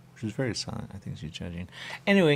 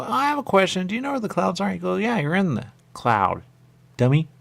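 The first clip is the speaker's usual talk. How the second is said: much higher, louder questioning voice; high put-on voice; 11 semitones higher, 6 dB louder than usual. Player asks the game characters where the clouds are. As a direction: play it bright and high.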